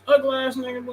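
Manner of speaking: ordinary talk, nothing marked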